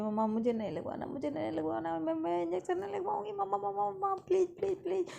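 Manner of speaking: put-on high whiny child begging mom not to get the shot; scared pleading. Girl begging her mom to skip the injection, high and whiny. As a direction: plead like a frightened little girl. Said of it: "अपनी मम्मा को कहती है".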